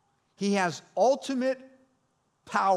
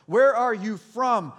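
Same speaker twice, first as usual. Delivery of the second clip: frightened demand; 3 semitones higher, 10 dB louder, slower than usual. A narrator's reading. Pilate questioning Jesus, read aloud.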